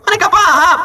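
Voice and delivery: high laughing burst; laughing squeal. Real, not acted. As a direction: crack up on the punchline. Punchline tertawa tinggi. (tertawa terbahak, nada tinggi)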